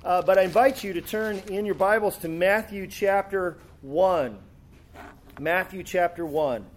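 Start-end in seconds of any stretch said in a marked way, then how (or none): none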